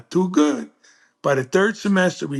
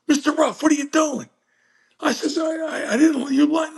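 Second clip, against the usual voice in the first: high, shrill-free protest of a cheated student, rising to a shout; high indignant kid; 12 semitones higher than usual. Retold or imitated. Student protests to Mister Ruff about the fake money, high and outraged. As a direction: squeal it like a betrayed teenager.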